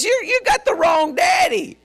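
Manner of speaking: high, shouted accusation; shouted rebuke. Retold or imitated. Jesus telling the Pharisees off, shouted rebuke. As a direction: call them out at a high pitch.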